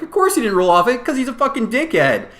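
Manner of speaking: high, loud indignant comeback; exasperated retort. Retold or imitated. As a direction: fire back in exasperation, pitch way up. Retold exasperated retort to the judge.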